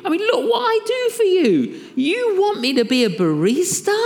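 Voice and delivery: high, incredulous protest; indignant whine. Retold or imitated. Preacher protests to the Lord he is no barista, in a high indignant whine. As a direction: act offended at being asked.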